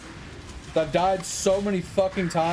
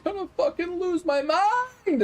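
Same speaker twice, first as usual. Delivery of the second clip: much higher, exasperated rant; frustrated outburst; 12 semitones higher than usual. Real, not acted. Streamer raging at the game after dying, high exasperated outburst. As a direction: blow up at the game.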